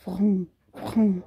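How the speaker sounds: vrum vrum like a car engine; rolling R like a motor; car engine noise. Shown as a demonstration. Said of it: "a gente faz o som do carro". (barulho de carro, vrum vrum)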